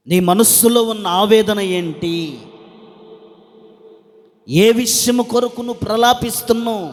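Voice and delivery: loud, ringing preacher's questions; God. Acted. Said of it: "దేవుడు నిన్నడుగుతున్న ప్రశ్న".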